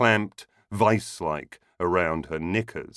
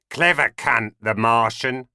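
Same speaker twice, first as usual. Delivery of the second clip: a hint of a pub regular's sneer, a bit louder; lightly voiced Val; 3 semitones higher, 3 dB louder than usual. Val to the assembled club members, mildly raised sneer. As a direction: toss off a barroom jibe with mild scorn. Val addresses the bar crowd.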